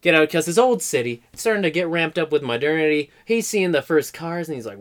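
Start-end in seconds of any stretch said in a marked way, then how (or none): none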